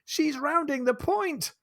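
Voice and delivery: put-on high, slow child's call; a seven-year-old ship's boy calling out from the yard arm; child crying out. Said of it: "arm and cries".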